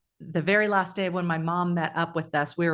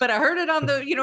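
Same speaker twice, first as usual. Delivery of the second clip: higher-pitched Southern imitation; mock Southern accent; 7 semitones higher, 3 dB louder, faster than usual. Passer-by telling a stranded motorist what he heard, mock Southern accent. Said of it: "I'm saying it like a Southern accent".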